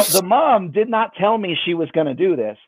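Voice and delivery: ordinary talk, nothing marked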